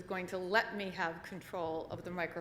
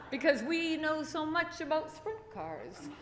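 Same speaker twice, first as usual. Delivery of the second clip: sarcastic retort; 6 semitones higher than usual. Retold or imitated. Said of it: "I'm like".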